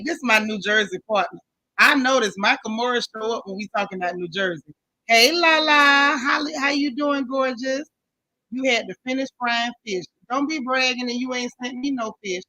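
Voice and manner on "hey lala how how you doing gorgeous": loud, higher-pitched drawn-out hello; calling out a greeting. Real, not acted. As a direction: sing out a hello to an arriving friend. Calling out to an arriving viewer.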